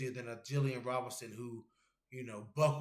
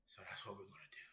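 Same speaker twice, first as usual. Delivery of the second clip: whisper; 3 semitones lower, 15 dB quieter, faster than usual. Real, not acted. Whispered aside about plans.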